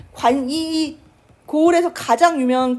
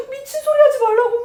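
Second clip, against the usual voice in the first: high, loud tearful outburst; angry mother; 10 semitones higher, 7 dB louder than usual. Retold or imitated. Mother refusing to abandon her daughter, a tearful angry cry. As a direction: snap back at her husband through tears. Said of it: "막 화를 내".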